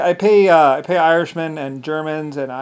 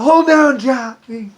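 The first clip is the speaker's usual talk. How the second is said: high, loud call to hold him; mock yelled order; 9 semitones higher, 6 dB louder, slower than usual. Henchman calls to Johnny to hold him, high shout. Men holding a victim down.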